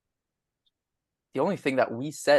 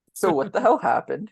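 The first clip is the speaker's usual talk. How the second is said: asked through a laugh; 6 semitones higher, 3 dB louder than usual. Real, not acted. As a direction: laugh while asking. Asks the question laughing.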